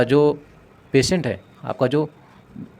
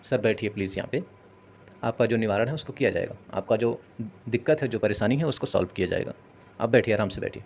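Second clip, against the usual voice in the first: softer, gentle request to sit; lovingly polite; 2 semitones lower, 6 dB quieter than usual. Shown as a demonstration. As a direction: coax them kindly to sit. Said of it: "अगर प्यार से बोल दोगे".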